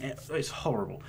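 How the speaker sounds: ordinary talk, nothing marked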